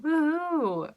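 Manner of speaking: a high, bright little whoop of joy; excited cheer. Real, not acted. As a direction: cheer like the readathon just began. Cheering the readathon start.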